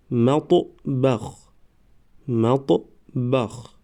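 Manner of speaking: slow, syllables held and separated; slow syllable reading. Shown as a demonstration. Teacher models first readings for learners, slow syllable by syllable. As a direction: sound it out for learners, pausing between syllables. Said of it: "بار یکم و دویم آهسته".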